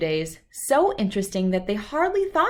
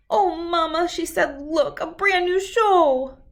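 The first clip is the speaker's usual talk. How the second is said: eager Sister; 9 semitones higher, 4 dB louder than usual. Acted. Sister begging Mama for new show.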